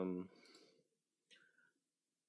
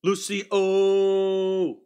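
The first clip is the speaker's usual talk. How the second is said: called out; 8 semitones higher, 8 dB louder than usual. Real, not acted. Calling out viewer's name.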